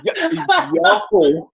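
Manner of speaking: put-on playful New York accent. Keeping up the New York act for co-host, mock accent. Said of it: "I'm New York for twenty minutes".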